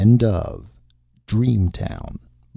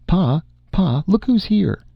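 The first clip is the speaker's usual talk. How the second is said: much higher, calling across the hall; 11 semitones higher than usual. Acted. Wife calling her husband to see the visitor, high and bright. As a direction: sing out for Pa to come.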